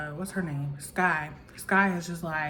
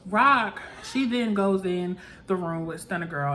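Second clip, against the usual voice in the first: ordinary talk, nothing marked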